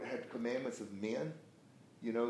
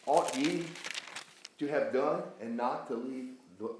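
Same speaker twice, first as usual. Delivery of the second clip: emphatic preacher reading, key words punched; forceful scripture reading; 3 dB louder than usual. Acted. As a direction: read it out as a rebuke, deliberately. Reads scripture forcefully to class.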